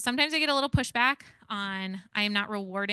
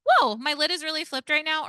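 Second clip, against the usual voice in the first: louder, higher exasperated announcement; mock flustered outburst; 7 semitones higher, 9 dB louder than usual. Shown as a demonstration. To kids, parent loudly admitting anger. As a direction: parent announcing their lid flipped, voiced louder and higher. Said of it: "You should say".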